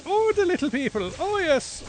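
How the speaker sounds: high-pitched stage Irish; exaggerated little Irishman accent; mock Irish accent. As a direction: put on a hammed-up Irish lilt, pitched right up. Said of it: "the little people are fucking Irish".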